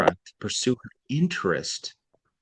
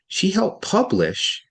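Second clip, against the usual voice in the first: She helped publish, raised high and slow, then a pause; pause after publish; 10 semitones higher, slower than usual. Shown as a demonstration. Teacher showing a pause after the word publish. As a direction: lift helped publish and hold a beat. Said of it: "now notice I pause there".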